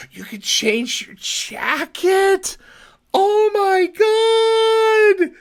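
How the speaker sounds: high, slow, loud delighted yelling; ecstatic high shouting. Pretend. Mock Mister Rogers excitement.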